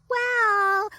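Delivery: put-on high, whiny little-girl voice; childlike voice. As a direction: sound like a guilty little kid. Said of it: "this childlike voice".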